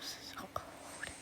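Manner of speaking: breathy, barely voiced; a soft, shivery pretend-cold whisper; shivering whisper. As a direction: whisper it like you're freezing. Pretend freezing, first take.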